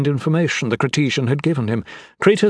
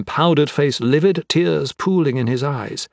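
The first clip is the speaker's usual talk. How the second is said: usual speaking voice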